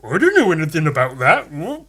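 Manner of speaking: feigning innocence, pitch rising; hammed-up innocent friend; mock innocent denial. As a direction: play dumb and innocent. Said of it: "this look on his face that just says".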